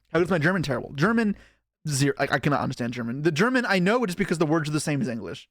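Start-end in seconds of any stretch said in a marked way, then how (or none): none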